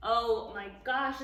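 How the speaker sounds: ordinary delivery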